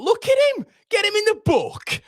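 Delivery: very high-pitched outburst at the match; outraged yell. Real, not acted. Fan demanding a booking from the referee, high-pitched outraged yell. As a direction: cry out in high-pitched indignation. Yelling at player on match screen.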